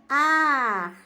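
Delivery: "ar" loud and long drawn out; stressed syllable. Shown as a demonstration. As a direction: stretch "ar" firmly as the strongest syllable. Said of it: "o som mais forte é no".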